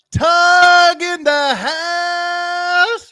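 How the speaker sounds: stretched, high hype call; announcer intro. Pretend. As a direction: hype him up like a ring announcer. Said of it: "we have the superstar of the day".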